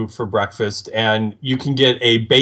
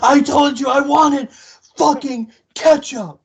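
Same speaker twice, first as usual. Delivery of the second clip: put-on much higher, strained kid's yell; mock yelling kid; 12 semitones higher than usual. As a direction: shriek it like a bratty kid throwing a tantrum. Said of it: "like, yelling at her".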